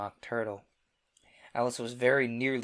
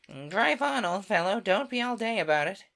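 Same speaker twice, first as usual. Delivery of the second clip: much higher and louder, impatient creature; gruff Gryphon; 9 semitones higher, 7 dB louder than usual. Acted. The Gryphon urging the Mock Turtle on, loud and high. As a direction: hurry the old fellow along loudly.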